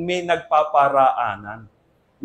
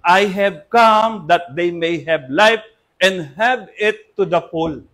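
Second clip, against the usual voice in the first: very slow, deliberate reading of the verse; slow scripture quote; 3 semitones lower, 4 dB louder, slower than usual. Acted. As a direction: read the verse slowly, word by word. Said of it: "Sabi ng Panginoong Jesus". (mabagal na pagbasa ng talata)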